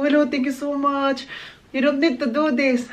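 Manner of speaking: fake politeness. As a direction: put on a fake humble thank-you. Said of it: "Ha, plastikan". (plastik na pasasalamat, mas mataas ang boses)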